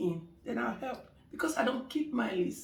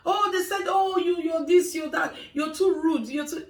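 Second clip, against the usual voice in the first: high, loud gossiping client; excited gossip; 6 semitones higher, 5 dB louder than usual. Retold or imitated. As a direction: rattle off the gossip, pitched up and loud. Clients listing what gossip says.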